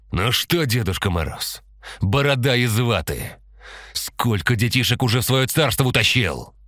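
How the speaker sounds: put-on sneering at the old man; angry taunt. A narrator's reading. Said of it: "С гневом в голосе произнёс Антон". (гневная насмешка)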